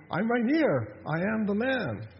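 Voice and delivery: high-pitched bid to be noticed; insistent protest. Acted. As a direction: protest as the overlooked man. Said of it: "he's standing right there saying".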